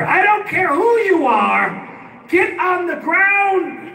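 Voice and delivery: high, drawn-out police order; cop over loudspeaker. Retold or imitated. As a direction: imitate a cop booming slowly over a loudspeaker. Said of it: "he comes over the loudspeaker".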